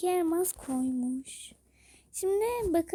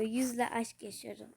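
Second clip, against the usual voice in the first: ordinary talk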